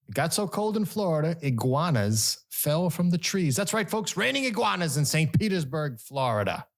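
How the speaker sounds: high, playful news tease; hammed-up excitement. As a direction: sell the weird news story.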